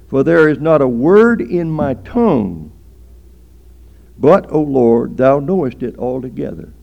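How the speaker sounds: loud, declaimed Bible verse; scripture recitation. Real, not acted. Preacher quoting a psalm to the congregation, loud scripture recitation. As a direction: proclaim the verse with force.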